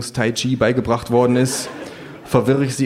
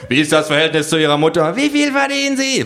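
raised, high survey voices piling in; mob of pollsters; 7 semitones higher, 4 dB louder than usual. A narrator's reading. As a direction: fire questions, climbing to a shriek. Mob of pollsters fires questions.

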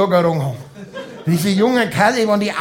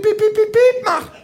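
high beeping like a bomb timer; mock beeping; 14 semitones higher than usual. Comedian imitating a bomb beeping, high piercing beeps. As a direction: squeak out the piep sounds high.

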